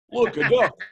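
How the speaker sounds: high, loud sudden exclamation; distracted old man. Forgetful old man breaking off his own intro, high and loud.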